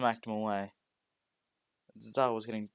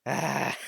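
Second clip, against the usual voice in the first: loud dark villain laugh; a menacing evil cackle; sinister laugh; 4 semitones higher, 8 dB louder than usual. Acted. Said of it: "the sinister voice starts, starts laughing".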